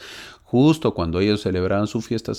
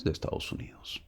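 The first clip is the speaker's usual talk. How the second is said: breathy secret-like whisper; whispering the answer to the class; 13 dB quieter, faster than usual. Real, not acted. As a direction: drop to a whisper as if telling a secret. Answers own question, hushed aside.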